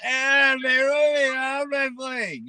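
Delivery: mocking internet whiner. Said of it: "They're going".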